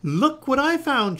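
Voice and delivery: high, loud, stretched-out holler; triumphant shout. A narrator's reading. Jessica rolls the stone home, shouting triumphantly. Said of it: "she shouted triumphantly".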